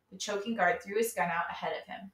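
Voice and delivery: ordinary delivery, nothing marked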